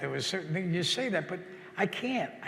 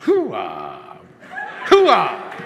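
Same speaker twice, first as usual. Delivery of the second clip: full shouted Hoo-ah, much louder; hoo-ah like you mean it; 2 semitones lower, 11 dB louder than usual. Shown as a demonstration. Host asks him to mean it, shouted hoo-ah. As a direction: yell it out with full conviction. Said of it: "say it like you mean it".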